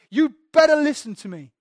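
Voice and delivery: Jesus confronting the Pharisees, stern and loud; stern warning. Retold or imitated. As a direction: warn them to their faces. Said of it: "pointing them right in the face".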